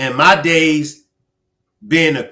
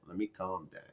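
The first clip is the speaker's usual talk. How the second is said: almost gone, very soft and lower; calming down; 7 semitones lower, 22 dB quieter than usual. Retold or imitated. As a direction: barely breathe it out to yourself.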